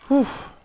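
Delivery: big high relieved ouf; relieved exhale. Real, not acted. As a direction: let the held breath out with a loud ouf. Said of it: "je retiens ma respiration".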